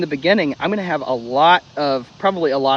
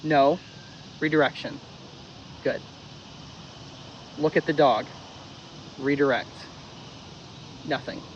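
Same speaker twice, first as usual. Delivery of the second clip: softer, level cue sequence; calm quiet cues; 8 dB quieter than usual. Shown as a demonstration. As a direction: drop the energy, keep it calm. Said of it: "No payment".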